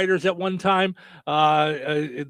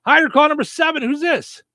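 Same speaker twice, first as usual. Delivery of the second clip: excited radio host; 9 semitones higher, 7 dB louder than usual. Real, not acted. Greets the winning caller.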